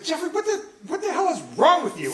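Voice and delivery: high-pitched exasperated dad; blowing up. Retold or imitated. Dad telling off his teenage son, blowing up. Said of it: "my dad just blew me up".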